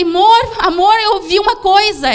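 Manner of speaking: calling him awake in alarm; wife waking her husband at night, calling out; retold call out. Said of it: "E eu gritei ele".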